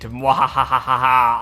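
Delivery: slow, low evil cackle; evil laugh. Acted. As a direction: cackle like a cartoon villain. Villain laugh.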